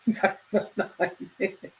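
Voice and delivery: talking through real giggles; laughing. Real, not acted. Streamer reacting to crude banter from chat, laughing. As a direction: let the giggle run through the words.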